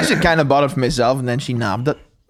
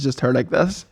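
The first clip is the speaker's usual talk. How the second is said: normal talking voice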